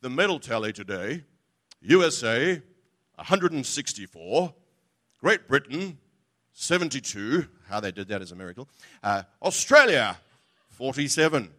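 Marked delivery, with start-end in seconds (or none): 0.0-7.5 lightly imitated sports broadcaster reading the tally; mock Australian sports news read; sports announcer. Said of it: "here's the classic phrase". 9.4-11.5 lightly imitated sports broadcaster reading the tally; mock Australian sports news read; sports announcer. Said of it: "here's the classic phrase".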